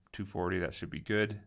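usual speaking voice

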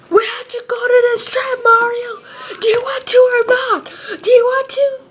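high, strained yelling; tantrum. Acted. Luigi demanding the trip from Mario, throwing a tantrum.

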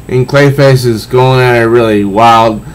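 usual speaking voice

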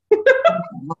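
a burst of high, loud laughter. Real, not acted. Guest laughing at her own age joke, high and loud. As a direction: cackle at yourself, delighted.

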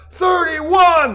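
high, loud, slow shout; crowd scream. Acted. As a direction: bellow it like a rally crowd. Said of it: "the crowd screamed".

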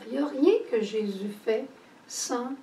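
ordinary delivery

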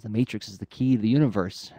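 ordinary delivery

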